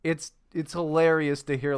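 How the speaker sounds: normal talking voice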